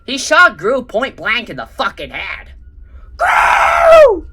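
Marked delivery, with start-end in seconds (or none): 3.1-4.3 very high, loud cry; anguished scream. Acted. Drew screaming as his brother is shot. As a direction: scream his name in shock. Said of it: "Drew hurled".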